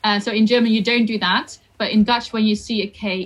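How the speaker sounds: ordinary talk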